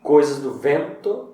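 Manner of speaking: Coisas do vento said slowly with VEN leaned on; slow down and stress the VEN in vento. Shown as a demonstration. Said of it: "temos o E com sílaba to-, forte".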